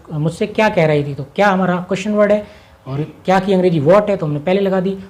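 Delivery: normal talking voice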